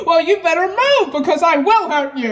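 ordinary talk, nothing marked

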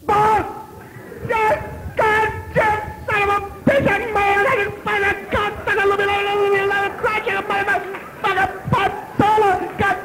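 very high, strained yelling rant; raging rant. Retold or imitated. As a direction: scream it at your agent in fury. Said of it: "and I'm going".

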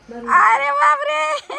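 high, loud and slow; a long amazed gasp of a word; surprised exclamation. Real, not acted. Mother amazed at her toddler's jump, loud and high.

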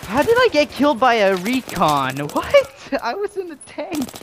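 high, incredulous complaint; disbelieving outcry. Real, not acted. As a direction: squeak of disbelief with a laugh. Killed unexpectedly, disbelief.

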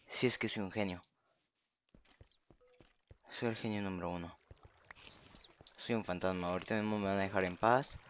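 smug quiet murmur. Real, not acted. Gloating after hiding.

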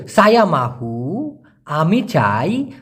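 very slow, word by word; slow breakdown. Shown as a demonstration. Teacher breaks the Malay phrase down for students, very slowly. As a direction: spell it out slowly for learners. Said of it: "slow word-by-word breakdown".

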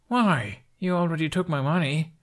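high, put-upon protest; object, a little wounded; chagrined objection. A narrator's reading. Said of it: "his chagrined objection".

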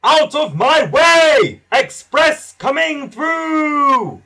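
booming shout. A narrator's reading. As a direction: clear the track with a big slow yell. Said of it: "A voice shouted".

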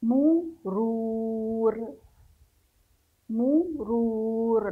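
مرور hugely stretched, "muu... ruuur"; sing-out length on مرور, very slow and held; long waw madd. Shown as a demonstration. Teacher shows ra followed by madd, very drawn-out demo. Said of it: "حرف الراء يصاحبه مد".

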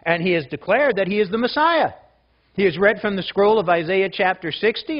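ordinary talk, nothing marked

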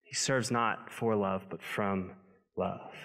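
slow, fading to soft on "from love"; stress on not for, from. Acted. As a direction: drop to near quiet on "from love". For vs from contrast.

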